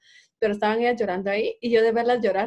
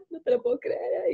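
a breathy, unvoiced imitation of crying friends; mock sobbing; 6 semitones higher, 4 dB quieter than usual. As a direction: whisper it like a crying child.

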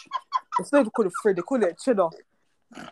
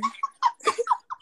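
sudden high laugh. Real, not acted.

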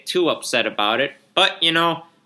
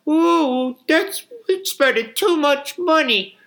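whiny rich owner; 7 semitones higher than usual. Retold or imitated. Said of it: "the owners that are all".

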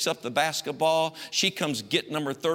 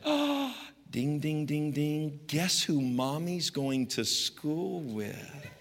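gleeful mom; 4 dB quieter, much slower than usual. Retold or imitated. Mother gloating to her son, softer and slow. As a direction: ring it out like she won. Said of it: "And she said".

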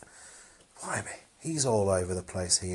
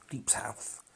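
dramatic whisper; 2 semitones higher, 9 dB quieter than usual. Acted. Repeating place guess dramatically.

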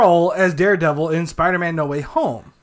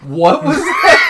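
surprised laughing shout; 6 semitones higher, 4 dB louder than usual. Real, not acted. Shocked laughing outburst.

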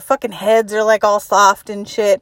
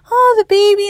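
cooing visitor; 11 semitones higher than usual. Retold or imitated.

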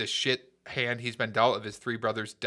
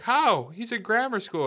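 mock disbelief; 10 semitones higher than usual. Soldier hearing his brothers have died, mock disbelief. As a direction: act out a stunned high-pitched protest. Retelling soldier's shocked reply to news.

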